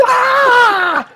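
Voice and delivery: put-on high shrieking "Ah!" like a scared kid; childlike scream. Seeing a huge spider in the kitchen, a childlike scream. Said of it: "I scream like a child".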